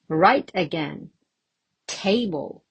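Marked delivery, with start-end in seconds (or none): 1.9-2.6 TAble, strong start falling away; stress on first syllable. Shown as a demonstration. Teacher confirming the stress mark, first syllable stressed. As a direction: lean on the "Ta" of Table. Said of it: "on the first syllable".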